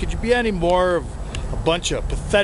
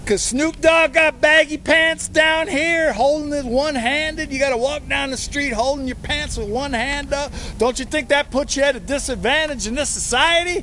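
much higher, heated lecture; worked-up rant; 7 semitones higher than usual. Acted. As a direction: get carried away scolding the kids. Worked-up rant about hats.

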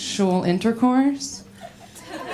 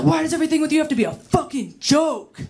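frustrated guy; 6 semitones higher, faster than usual. Retold or imitated. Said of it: "He was like".

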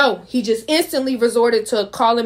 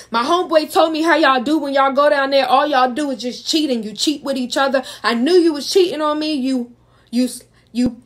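mock accusing; 4 semitones higher than usual. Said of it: "he just keeps saying".

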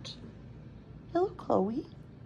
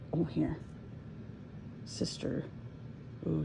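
ordinary talk

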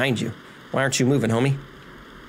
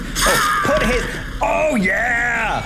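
high, slow-stretched cheer; triumphant shout; 9 semitones higher, much slower than usual. Real, not acted. Gamer winning a bloody fight, triumphant shout. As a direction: whoop it up as the hit lands.

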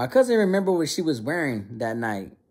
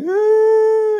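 long, loud, very high bawl; imitated little kid's sustained wail; hammed-up childish bawling; mock crying wail; 9 dB louder than usual. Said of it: "and I was crying".

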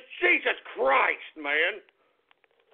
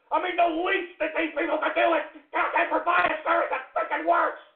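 ordinary delivery

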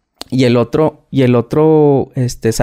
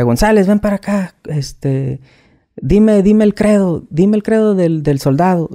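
bossy sergeant; 6 semitones higher, slower than usual. Retold or imitated. Sergeant orders him at lunch.

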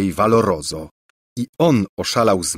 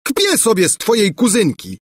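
roaring; 12 semitones higher than usual. A narrator's reading. Bulbo roaring at rival prince, mock furious. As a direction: bellow your claim like a jealous fool.

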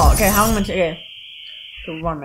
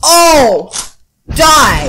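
loud, higher outburst at the game; startled battle cry; combat shout; 5 semitones higher, 11 dB louder than usual. Real, not acted. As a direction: yell it in the heat of a fight. Panicked shout amid gameplay combat.

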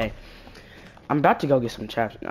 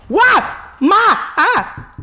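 high, loud frightened cry; startled scream; scared yelp; 6 dB louder, much slower than usual. Real, not acted. Jump scare reaction.

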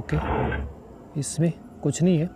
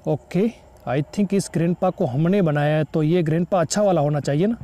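ordinary talk

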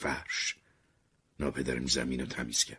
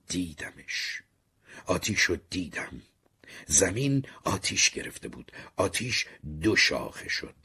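plain reading voice

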